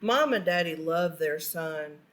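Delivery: usual speaking voice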